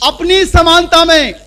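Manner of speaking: loud, high proclaiming; forceful preaching. Acted. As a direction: hammer the answer home. Preacher answers his own question.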